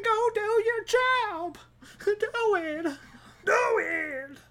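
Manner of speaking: hammed-up nagging manager; mock boss. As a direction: berate the worker on the ground. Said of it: "they'd be like".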